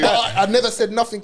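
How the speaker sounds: laughing. Real, not acted. Laughing while telling story.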